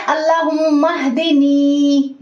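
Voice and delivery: अल्लाहुम्मा हदिनी recited slowly, the दी of हदिनी stretched for two counts; proper tajweed recitation. Shown as a demonstration. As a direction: recite हदिनी with the long दी held. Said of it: "इसको हम two seconds के लिए खींच के अदा करेंगे".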